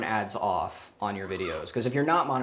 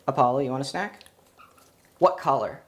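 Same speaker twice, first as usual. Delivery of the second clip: talking to a pet; 2 semitones higher, 3 dB louder than usual. Real, not acted. Training a parrot.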